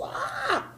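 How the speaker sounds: high pained yelp; a mock cry of stiffness from the cold; strained cry. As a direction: let out a tight-muscle yelp. Describing cold tight muscles.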